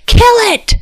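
high, frantic order; cried command. A narrator's reading. King orders his huntsmen to kill the fox, high urgent cry. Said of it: "King Haite cried".

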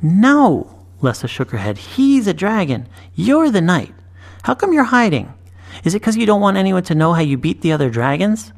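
put-on high, earnest girl's voice; bright young girl. A narrator's reading. Girl correcting the boy plainly. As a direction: insist like a matter-of-fact child.

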